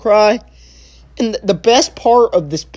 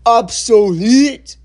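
put-on drawn-out accent, pitched up; slow, higher-pitched character impression; Broken Hardy; 5 semitones higher, 4 dB louder, much slower than usual. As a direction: stretch it slowly in a put-on wrestler's accent. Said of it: "broken Hardy's accent there".

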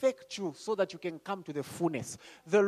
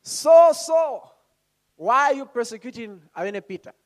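voice from heaven; 4 semitones higher, 8 dB louder than usual. Acted. Preacher teasing with a misquote, high heavenly call. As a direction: cry the name out, then ease off. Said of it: "heard a voice saying to him".

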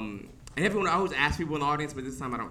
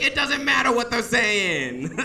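higher, clipped retort from an offended vegan; mock angry date; 8 semitones higher, 4 dB louder, slower than usual. A vegan date, offended by the joke, snapping back in mock anger. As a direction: act out the date snapping back, half-serious. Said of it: "Immediately he got mad".

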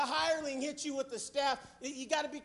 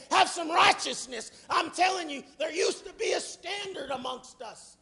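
loud, higher preaching shout; fiery pulpit preaching, pushing higher and louder; 6 semitones higher, 6 dB louder than usual. Acted. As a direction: build the sermon to a roar. Full-force preaching to the church.

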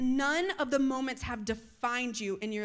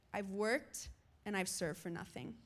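low, soft and defeated; acted discouragement; 4 semitones lower, 7 dB quieter than usual. Preacher voices Isaiah doubting his labor, discouraged. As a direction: let it sink, worn out, with pauses.

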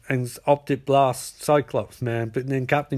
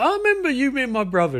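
high, quick retold protest; hammed-up indignant sibling; mock indignant; 12 semitones higher, faster than usual. Retold sibling quarrel, indignant reply.

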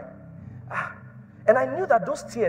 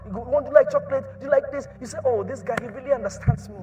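louder, higher impassioned sermon voice; fiery preaching; 6 semitones higher, 8 dB louder than usual. Acted. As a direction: drive the message home to the congregation. Preaching at full force.

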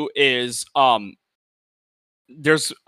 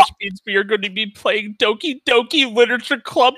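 loud, high announcing shout; excited shout; 10 semitones higher, 10 dB louder than usual. Real, not acted. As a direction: announce it with a yell.

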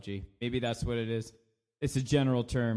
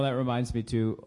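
ordinary talk

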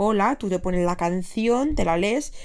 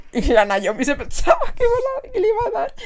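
laughing; 10 semitones higher, 7 dB louder than usual. Real, not acted. Laughing at the cartwheel.

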